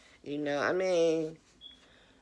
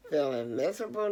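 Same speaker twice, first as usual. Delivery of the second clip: normal talking voice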